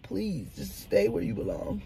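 usual speaking voice

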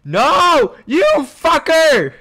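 real rage yell at the screen; furious yell. Real, not acted. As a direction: scream it at the opponent in real fury. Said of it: "I'm so mad right now".

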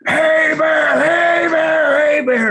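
high, loud shout to scare off a bear; yelling as loud as possible. Retold or imitated. Charging downhill, yelling at the grizzly, loud high shout. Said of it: "as loud as I can".

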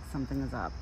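ordinary talk

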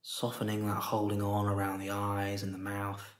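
soft, unhurried meditation voice with long pauses; guided meditation. Real, not acted. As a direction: guide them gently into stillness, softly, leaving space after each phrase.

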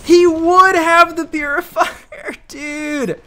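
high, loud, drawn-out complaint; real gamer howl of frustration; exasperated wail. Real, not acted. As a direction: wail it slowly in disbelief. Killed by purifier, exasperated outburst.

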